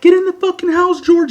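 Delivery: very high, loud mock wail; imitated crying girl. Girlfriend begging them not to call police, mimicked crying wail. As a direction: plead in a shrieky falsetto, acting her panic. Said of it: "The girl starts crying".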